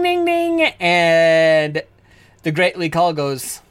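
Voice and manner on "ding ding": high ringing "ding, ding"; bell imitation. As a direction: ring it out like the fight bell. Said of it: "the bell goes".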